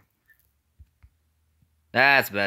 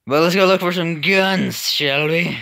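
playful sing-song; 9 semitones higher, 7 dB louder than usual. Acted. Playful invitation to viewers.

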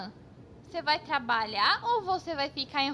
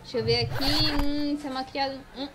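ordinary delivery

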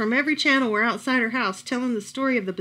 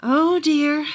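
sadly; 4 semitones higher, 6 dB louder than usual. A narrator's reading. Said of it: "the old woman said sadly".

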